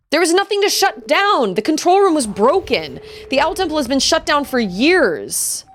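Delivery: high-pitched, aggrieved defence; pleading protest. Acted. Accused owl explains the temple was shut down, aggrieved.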